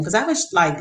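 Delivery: usual speaking voice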